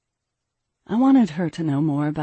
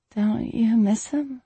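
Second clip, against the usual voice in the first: put-on slow, higher-pitched young girl; wistful daughter; 5 semitones higher, slower than usual. A narrator's reading. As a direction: ask mother gently, drawn out and high. Daughter timidly asks about father.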